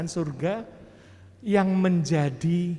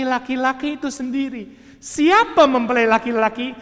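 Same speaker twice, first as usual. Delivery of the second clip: louder, higher fervent sermon cry; preaching at full force; 6 semitones higher, 8 dB louder than usual. Acted. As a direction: thunder it out to the congregation. Preaching climax.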